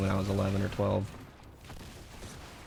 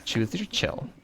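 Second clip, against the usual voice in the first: sassy lisped "chill"; condescending flamboyant lisp; 4 semitones higher, 4 dB quieter, slower than usual. Retold or imitated. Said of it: "You have to say it with that lisp".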